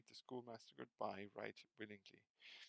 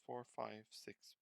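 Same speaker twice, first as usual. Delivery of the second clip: plain reading voice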